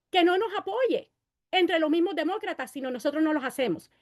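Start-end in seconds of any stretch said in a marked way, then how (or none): none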